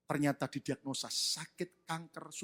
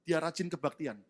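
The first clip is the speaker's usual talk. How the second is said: usual speaking voice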